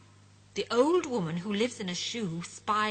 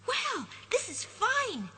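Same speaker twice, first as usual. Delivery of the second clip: high, slow bewildered remark; puzzled surprise; 12 semitones higher, slower than usual. Acted. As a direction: wonder where he is. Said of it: "he says".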